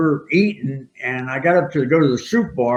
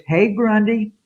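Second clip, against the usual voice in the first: higher, sing-song call of a smitten fan; mock woman calling; 9 semitones higher than usual. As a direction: imitate her calling out to him. Woman calls across the restaurant.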